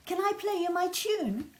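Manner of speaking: put-on high, loud pleading child; eager child voice. Lester begging his mum to hear his tune, high and eager. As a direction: ask like a keen little boy.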